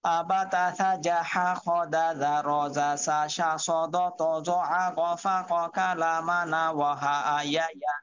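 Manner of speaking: syllables run on evenly in one pull; one-breath recitation. Shown as a demonstration. Teacher showing pronunciation drill, all in one breath. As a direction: chant the letter sounds straight through without stopping. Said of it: "এক নিঃশ্বাস হয়ে যায়".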